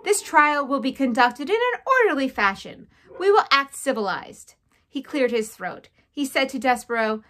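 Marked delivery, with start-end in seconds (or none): 0.0-4.5 loud, raised, emphatic declaration; stern authority. A narrator's reading. Head mouse calling the trial to order, stern. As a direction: lay down the rules to a crowd.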